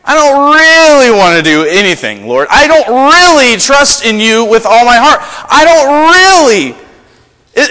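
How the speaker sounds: mock reluctant whine. Believers dodging what God asks, hammed-up whine. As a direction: whine your way out of obeying. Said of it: "we say".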